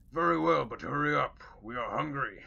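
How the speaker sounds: loud orc leader, impatient; a hungry orc hurrying them along. Acted.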